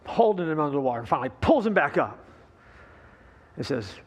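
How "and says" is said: usual speaking voice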